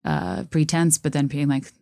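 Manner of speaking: normal talking voice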